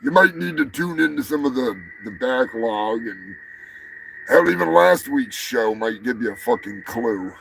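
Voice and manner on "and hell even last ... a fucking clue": usual speaking voice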